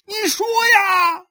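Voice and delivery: very high, drawn-out yell; enraged shout. Acted. Husband demands his weeping wife confess, shouting. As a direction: scream for an answer. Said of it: "许三观再喊道".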